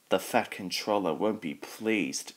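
ordinary delivery

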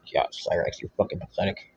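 low scornful aside to himself; muttered aside. Real, not acted. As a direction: mutter it under your breath. Quiet put-down after mock plea.

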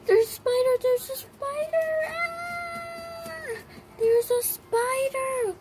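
hammed-up kid terror at a spider; pretend spider panic. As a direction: squeal it like a toy spider is chasing you. Pretend panic over toy spider.

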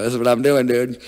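defiant loud answer to worried parents; loud retort. Retold or imitated. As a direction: blurt it out loud and high.

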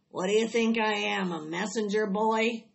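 loud, indignant grumble; complaining rat, pushed out loud; grumbling rat. A narrator's reading. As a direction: grumble it out as a put-upon rat. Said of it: "grumbled the rat".

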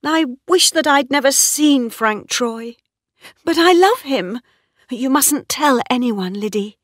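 high, agitated woman's confession; distressed cry. A narrator's reading. Mistress confesses love to her maid, distressed. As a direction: pour it out urgently, pitch well up. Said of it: "cried Bathsheba".

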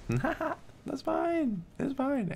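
squeaky high sarcastic "it's fine"; mock cheerful falsetto. Real, not acted. Streamer to chat after a bad spin, high mock-calm squeak. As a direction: chirp it in a fake-happy high voice.

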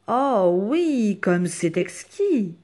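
loud, swooping, savouring exclamation; refined delight. Acted. Rabbit praising maman's soup, drawn-out delighted refinement.

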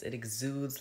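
normal talking voice

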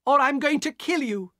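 high, loud desperate demand; cried out. Acted. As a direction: scream at him to give them back. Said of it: "Elena cried".

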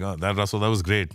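lightly imitated Indian accent. Indian fan praising the comedian's accent joke, light Indian accent. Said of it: "The funny thing for me is India".

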